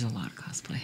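a hushed, breathy whisper; whispered aside. Shown as a demonstration. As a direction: whisper it secretly. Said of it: "whispered, then repeated normally".

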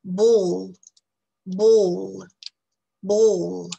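"Ball" with a long rounded O, loud. Shown as a demonstration. Teacher drilling the long O, loud. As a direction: stretch the O in "Ball". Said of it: "c'est une voyelle longue".

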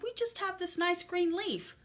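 high little ants voice; tiny ants voice. Acted. The ants offering the rat their leaf, high and small. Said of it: "the ants replied".

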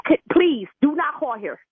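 usual speaking voice

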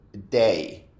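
"day" for THEY, with a hard D. Shown as a demonstration. Teacher giving wrong examples on purpose, "day" with D in place of TH. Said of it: "también lo dice como una D".

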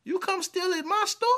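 high-pitched affronted question; indignant store owner. Acted. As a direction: act out outraged disbelief at a thief. Acting out confronting a shoplifter.

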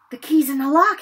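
an urgent aside with some force; urgent mutter. A narrator's reading. Boy plotting outside the troll's room, urgent mutter. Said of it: "Harry muttered".

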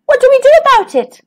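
high, quick, excitable bird; agitated high-pitched rush; urgent question. A narrator's reading. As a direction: fire it off in a fluster. Bird reacts to stolen eggs.

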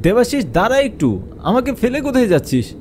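high, fast call to a friend; calling after someone. Acted. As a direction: call out for him to wait. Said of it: "তাকে অনেকবার ডাক দিলাম".

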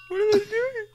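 very high, squeaky question; squeaky bewilderment. Pretend. Gamer watching enemies from hiding, squeaky bewilderment. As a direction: squeak it in baffled alarm.

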